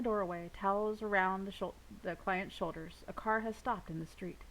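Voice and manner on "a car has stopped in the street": plain reading voice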